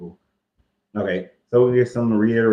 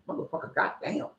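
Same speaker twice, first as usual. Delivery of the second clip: mock cursing; 6 semitones higher, 9 dB quieter than usual. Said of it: "when you cursing it like".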